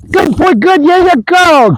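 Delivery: high-pitched excited praise; real high, eager cheering to a dog. Real, not acted. Trainer praising dog in play.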